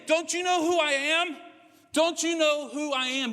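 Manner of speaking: angry noble refused at the door, shouting; indignant outburst. Retold or imitated. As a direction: demand your due like an offended general. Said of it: "That's what Naaman is feeling".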